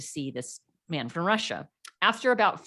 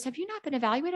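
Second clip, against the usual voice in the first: higher, imitating an irate doctor; mock indignant demand, pitched up; retold exasperated fury; 9 semitones higher, faster than usual. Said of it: "he was furious".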